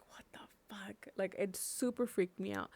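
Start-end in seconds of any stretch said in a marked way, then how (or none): 0.1-1.1 breathless whispered disbelief; shocked whisper. Retold or imitated. Californian at a Houston party, whispering in shock. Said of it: "I was just kinda like".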